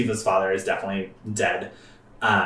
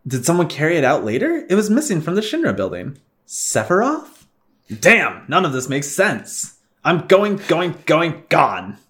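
voicing game dialogue, raised pitch; game character voice; 6 semitones higher than usual. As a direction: read the character lines in character.

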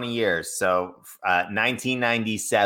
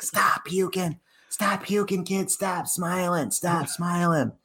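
much higher, slow, barking Boston coach; Boston accent; 10 semitones higher, slower than usual. Retold or imitated. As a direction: yell at the kid like a hard-nosed Boston coach. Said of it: "you were using the Boston accent".

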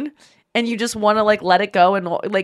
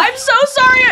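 much higher, louder mortified burst; flustered apology; 6 dB louder than usual. Retold or imitated. Apologizing to Adam Sandler after a botched hug, flustered. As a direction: blurt it out, mortified.

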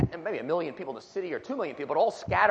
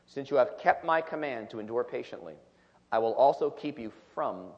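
scripture reading; 5 semitones lower than usual. Acted. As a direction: read each phrase deliberately, with weight. Reads scripture aloud.

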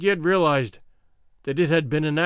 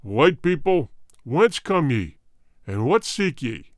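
warrior king; 3 semitones lower than usual. Acted. King questions the strangers.

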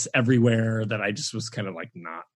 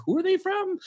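much higher, mock baffled question; puzzled wonder. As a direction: pitch it way up like a baffled viewer. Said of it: "but you're like".

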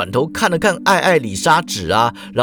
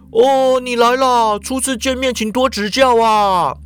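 effusive middle-aged boss; 7 semitones higher than usual. A narrator's reading. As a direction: greet a newcomer with hearty familiarity. Middle-aged Ikeda greets visitor. (热情招呼的中年社长)

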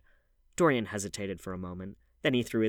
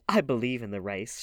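louder, emphatic declaration; crying out; 3 semitones higher, 7 dB louder than usual. A narrator's reading. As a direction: proclaim it with force. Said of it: "she cried".

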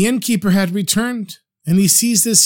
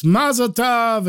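drawn-out, raised-pitch congratulation; mild mock cheer; 5 semitones higher, slower than usual. A narrator's reading. Drunken guests congratulating the mock bride and groom, mild cheer. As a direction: call it out slowly, without much volume. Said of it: "And everyone shouts".

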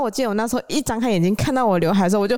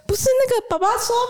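flustered hairdresser; faster than usual. Retold or imitated. Hairdresser pleads for help.

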